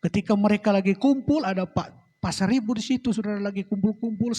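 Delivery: normal talking voice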